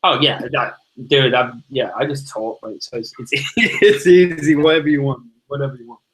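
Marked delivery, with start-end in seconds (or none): none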